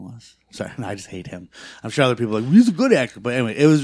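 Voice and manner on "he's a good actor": high, loud mimicked objection; mock defending. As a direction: imitate fans protesting in a raised voice. Mimics other people defending the actor.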